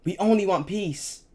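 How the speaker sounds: high, loud terrified plea; mother screaming at the soldiers; screaming with fear. A narrator's reading. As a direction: beg for your family's life. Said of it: "screamed with fear".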